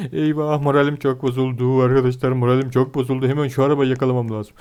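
low, deflated voice; dejected. Real, not acted. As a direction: sink into gloom over the lost car.

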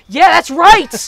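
very loud, high, drawn-out yell; triumphant cheer. Acted. Readers cheering the father's charge, triumphant yell. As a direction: cheer it out at full volume.

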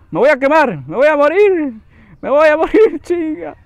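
high, loud panicked cries; panicked yelling. Real, not acted. Gamer screaming as his character catches fire. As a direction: scream it like you're really burning.

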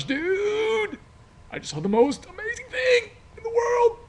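excited call to a friend about the crash; over-the-top excited. Shown as a demonstration. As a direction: gush wildly to your buddy. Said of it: "Now I need to call my friend".

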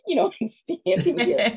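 laughing through the words, high. Real, not acted. Author telling the brother he need not buy it, laughing. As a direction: giggle it out, embarrassed.

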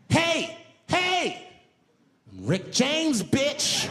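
high, loud, brash celebrity shout. Retold or imitated. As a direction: belt it out like a wild party star. [name] greeting at park.

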